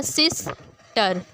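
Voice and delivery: "Sister" with a pause before टर; split into syllables. Shown as a demonstration. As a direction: say "sister" in two separated beats. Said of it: "word के दो हिस्से हो रहे". ("Sister" को सिस और टर में तोड़कर)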